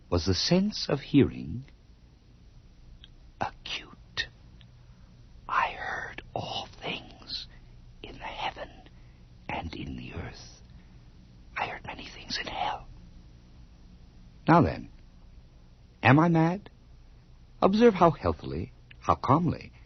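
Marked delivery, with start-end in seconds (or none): 3.4-12.9 eerie whisper. Acted. Narrator whispering to the listener about hearing all things. 14.5-19.7 higher, louder challenge; defiant challenge. Acted. Narrator demanding whether the listener thinks him mad.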